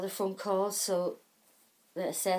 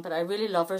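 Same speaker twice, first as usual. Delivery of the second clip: ordinary talk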